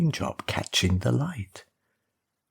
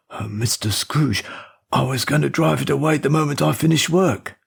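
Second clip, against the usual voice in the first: put-on higher, fast and anxious old servant voice; an old chauffeur stammering an excuse to his boss; nervous old chauffeur; 5 semitones higher, faster than usual.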